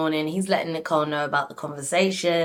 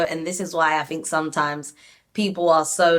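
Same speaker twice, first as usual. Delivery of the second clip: ordinary delivery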